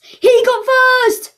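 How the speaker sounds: high, loud, slow drawn-out cheer; delighted winner call; excited announcement. Real, not acted. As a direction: stretch it out like a game-show reveal. Announcing friend's first win.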